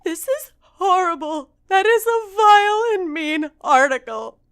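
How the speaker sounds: loud high mock outrage. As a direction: wail it in fake horror. Mock horror at article.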